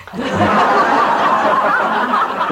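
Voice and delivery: high breathy burst of laughter; real laugh of disbelief. Real, not acted. Teacher laughs at shocking Zen story, real laugh.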